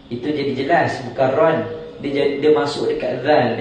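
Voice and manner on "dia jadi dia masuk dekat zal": ordinary delivery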